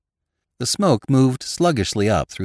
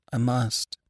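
higher, understated misery; miserable; 6 semitones higher, 3 dB quieter than usual. A narrator's reading. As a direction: answer with quiet, helpless misery. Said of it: "she said miserably".

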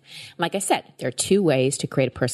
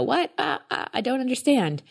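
confused; 8 semitones higher than usual. Retold or imitated. Listener's confused mind.

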